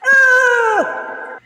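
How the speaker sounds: mock cry. Player cries out at shame.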